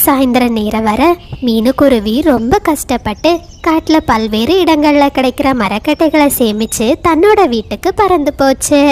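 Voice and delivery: usual speaking voice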